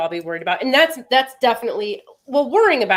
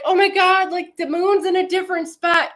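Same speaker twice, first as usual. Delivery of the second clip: mock TikTok panic; 9 semitones higher, 4 dB louder than usual. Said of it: "they're like".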